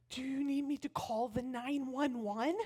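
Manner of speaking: put-on carefully pronounced child's question; articulate little girl. Oldest girl offering help to babysitter, careful child voice. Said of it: "she's very articulate".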